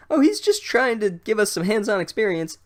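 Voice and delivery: high, earnest schoolgirl voice; eager defender. Retold or imitated. Bossy Girl justifying the teacher to the boys, high and earnest.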